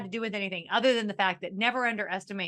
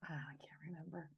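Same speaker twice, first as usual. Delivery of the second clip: whisper; 3 semitones lower, 22 dB quieter, faster than usual. Real, not acted. Unvoiced aside.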